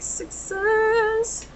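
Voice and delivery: high, drawn-out spirit call; excited guides. Retold or imitated. Guides excited for pile one, a high ringing call. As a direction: float it up high like a bell. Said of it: "They're, like, really excited".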